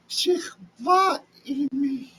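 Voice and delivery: high, slow, drawn-out seductive plea; seductive wife. Acted. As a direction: coax him slowly, pitched high like the temptress. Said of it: "And she says".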